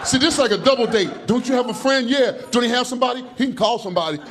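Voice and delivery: ordinary talk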